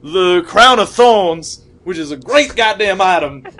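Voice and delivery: loud, emphatic, cursing tirade; Samuel L. Jackson. Acted. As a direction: belt it out with hard-punched swear words. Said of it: "All right, Samuel L. Jackson".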